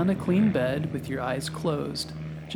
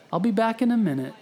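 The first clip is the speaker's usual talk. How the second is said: lightly voiced kind nurse, higher, quicker; 6 semitones higher, 3 dB louder, faster than usual. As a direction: reassure a patient as a kind nurse. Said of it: "she says".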